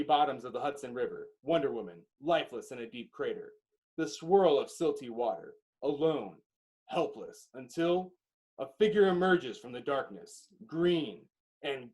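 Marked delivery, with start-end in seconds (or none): none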